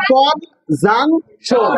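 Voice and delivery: কন, জাং, শোন with a pause after each; teacher spacing out কন, জাং, শোন one by one; syllable by syllable. Shown as a demonstration. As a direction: sound out কন, then জাং, then শোন. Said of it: "এইভাবে সিলেবল ভাঙ্গি ভাঙ্গি করবা".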